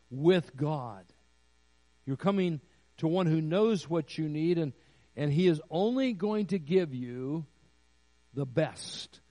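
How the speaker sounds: rising pulpit emphasis; preaching. Acted. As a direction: drive the point home to the congregation. Preaching intimacy with God.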